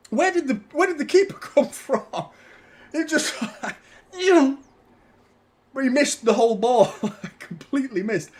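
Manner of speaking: laughing. Real, not acted. Laughing at keeper error.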